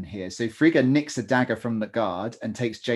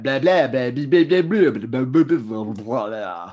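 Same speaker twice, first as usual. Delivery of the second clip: loud mock elf language chant; elf gibberish; 5 dB louder, slower than usual. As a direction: babble like a villainous elf. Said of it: "talking in their elf language".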